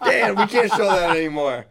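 high laughing protest. Real, not acted. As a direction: giggle through it.

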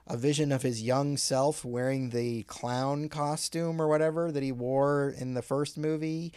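ordinary delivery

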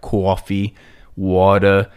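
exaggerated Long Island accent, dragged out; broad, drawling Long Island accent; thick Long Island accent. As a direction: imitate Long Island relatives, loud and slow. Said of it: "Sounds even worse. They say, like".

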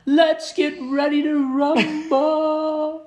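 high, loud, slow drawn-out rumble call; ring announcer. Retold or imitated. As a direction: stretch every word like a boxing announcer. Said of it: "what did they say?".

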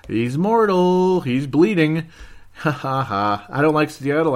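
mock dramatic gloating. Real, not acted. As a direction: savor the rival's downfall. Gloating over rival's bad game.

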